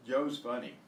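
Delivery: ordinary talk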